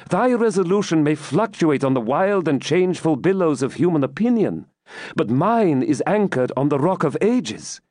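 plead, then affirm your faith with conviction; Rebecca, earnest and steadfast. Acted.

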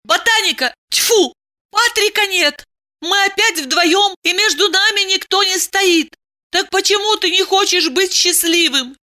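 high-pitched nagging woman's voice; exasperated Diana. Acted. Woman reproaches lover for seeking a fight, exasperated.

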